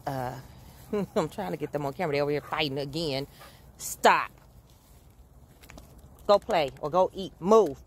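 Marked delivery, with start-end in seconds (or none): 3.8-7.8 commanding the dogs. Real, not acted. Ordering fighting puppies apart.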